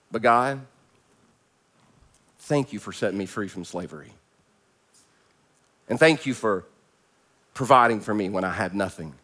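low, softened prayer of thanks; humble prayer. Acted. Israelites thanking God for freeing them, humble prayer. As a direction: pray it gently, with pauses between thanks.

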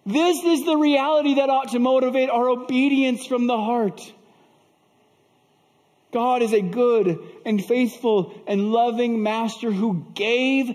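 high, forceful pulpit delivery; fervent, ringing sermon climax; impassioned preaching. Acted. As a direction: drive each phrase home to the congregation.

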